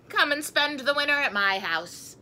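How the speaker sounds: calling out. A narrator's reading. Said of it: "cried Gus".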